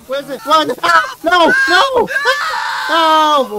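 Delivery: high panicked screams and cries; screaming in panic. Real, not acted. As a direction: shriek as the moth chases you. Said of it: "why am I screaming".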